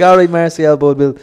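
reply loudly to a retold complaint; loud mock reply.

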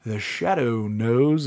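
very slow radio tagline; the Shadow. Retold or imitated. Recalling old radio plays, imitated Shadow voice. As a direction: draw out each word ominously. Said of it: "It was a voice just like that".

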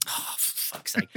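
slow, whispered groan of exasperation; exasperated whisper. Real, not acted. Recalling mates setting up a fight, whispered exasperation. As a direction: breathe it out, fed up.

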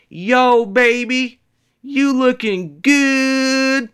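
high, loud, slow drawn-out pickup line; catcalling Puerto Rican guy. Retold or imitated. Man in stairwell hitting on a lady, slow loud catcall. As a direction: lay on the smooth catcall. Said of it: "this is what he said verbatim".

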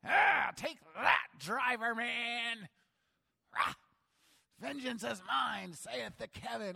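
high, loud, slow gloating yell; hammed-up vengeful driver; preacher acting out his own road rage, loud and gloating; mock road rage. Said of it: "I wanna hit my brakes".

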